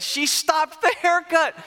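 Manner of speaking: high, loud and drawn out; incredulous outburst. Real, not acted. Telling the audience about mom quitting mid-haircut, incredulous outburst. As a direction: stretch it out in loud, high disbelief.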